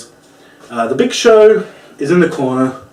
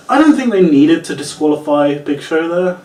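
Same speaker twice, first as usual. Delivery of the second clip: usual speaking voice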